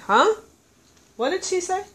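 imitating a baffled listener straining to hear; puzzled listener. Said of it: "you kinda went".

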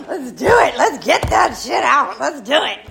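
loud, rousing battle cry, played up; hammed-up gung-ho urging with a laugh; wildly eager. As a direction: act out charging in, impatient to start. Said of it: "so anxious to do step four".

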